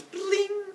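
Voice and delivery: high ringing bling; high cartoon strum; plucked string noise. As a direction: imitate a string twang. Hermes plucks the lyre.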